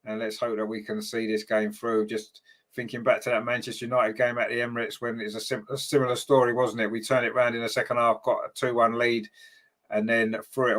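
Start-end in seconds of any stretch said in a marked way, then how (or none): none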